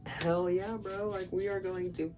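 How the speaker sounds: high, pumped-up bro voice; hyped bro cheer. Retold or imitated. Girls celebrating the trip, in a high hyped bro voice. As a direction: hype it up like a bro.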